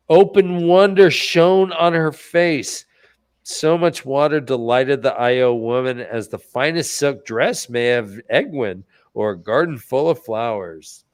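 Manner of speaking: slow reading aloud; story narration. Acted. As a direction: read it like a book passage.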